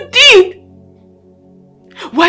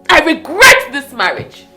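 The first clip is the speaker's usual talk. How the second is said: forceful punch on "regret"; bitter outburst; 3 semitones lower than usual. Acted. Wife declaring regret to her husband, bitterly forceful. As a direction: burst out, leaning hard on "regret".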